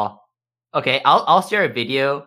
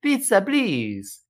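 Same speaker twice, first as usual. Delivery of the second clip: high, slow broken English plea; 9 semitones higher, slower than usual. Retold or imitated. Girlfriend in a date skit pleading for pizza in high slow broken English. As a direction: drag out the request slowly. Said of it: "why is her English bad".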